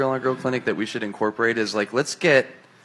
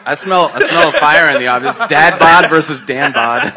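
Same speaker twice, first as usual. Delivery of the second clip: hype announcer; 3 semitones higher, 8 dB louder than usual. Real, not acted. Hyping a throwdown.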